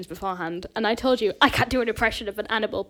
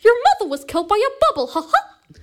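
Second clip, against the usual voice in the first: fast, high, louder cartoon mouse voice ending in a squeaky ha ha; Mickey Mouse again; 11 semitones higher, 6 dB louder than usual. [name] repeats her Mickey line, faster and squeakier. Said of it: "The best impression I can do".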